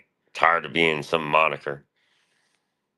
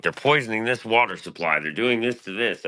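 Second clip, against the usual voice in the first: higher, lightly hammed-up alarm; mock paranoid warning; 8 semitones higher than usual. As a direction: play it like a half-joking conspiracy nut. Mock conspiracy rant as himself.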